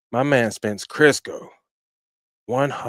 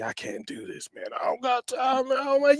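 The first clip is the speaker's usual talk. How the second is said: whiny excuse-maker; 10 semitones higher than usual. Retold or imitated. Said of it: "People tell me".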